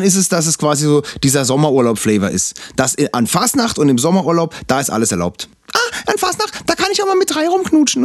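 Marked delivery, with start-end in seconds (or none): 5.7-8.0 high, giddy mock voice of a reveler; mocking party girl voice. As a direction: squeal it like a carefree partygoer. Mocking carnival-goers' attitude.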